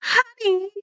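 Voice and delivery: high, loud call across the room; old woman calling sweetly. A narrator's reading. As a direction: call him up the stairs. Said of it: "Miss Dutz called".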